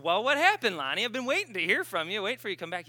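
high, loud, eager greeting easing off; retold excited question. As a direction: pipe up eagerly, as if long awaiting news. Acting out his eager greeting.

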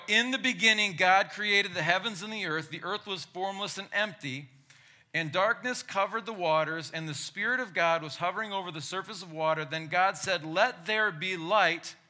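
loud, high preacher reading scripture; booming biblical narration. Acted. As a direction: proclaim it like from the pulpit. Reads Genesis aloud to congregation.

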